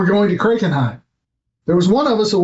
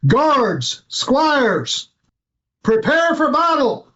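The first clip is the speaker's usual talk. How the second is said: pitched-up rallying call to the guards; yell; 7 semitones higher, much slower than usual. Acted. Lord bursting into office, calling guards to battle. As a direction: rouse the castle, voice lifted high. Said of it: "open the door to the office and yell".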